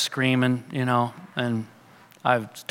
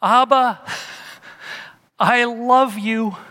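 mock cry; 7 semitones higher, slower than usual. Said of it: "he hears your cry".

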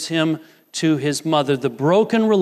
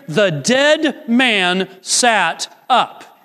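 very slow, deliberate; 5 semitones higher, much slower than usual. Real, not acted. Preacher reads the verse to the congregation, very slow and weighty. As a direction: lean on each word of the verse, pausing between them.

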